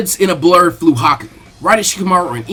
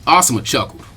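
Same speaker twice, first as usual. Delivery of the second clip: ordinary delivery, nothing marked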